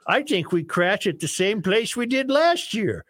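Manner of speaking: bad singsong Norwegian for the joke; worst Norwegian voice. Host telling Sven and Ole joke, worst Norwegian accent. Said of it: "use your worst Norwegian voice".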